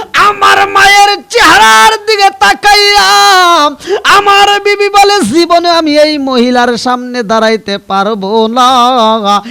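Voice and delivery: very loud, high chanted cry; impassioned sermon wail; full-force preaching. A narrator's reading. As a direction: belt it out at full force, easing in pitch at the end. Preacher cries out wife's rejection.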